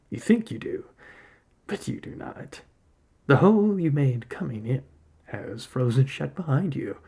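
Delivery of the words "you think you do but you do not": breathy unvoiced taunting whisper. Spirit whispering to the trapped hunter, taunting. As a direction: hiss it softly, like a secret threat.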